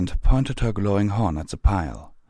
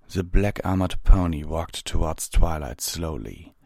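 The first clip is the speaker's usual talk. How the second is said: plain reading voice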